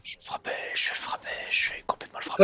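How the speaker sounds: hushed repeated threat; whisper. Real, not acted. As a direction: whisper it under your breath.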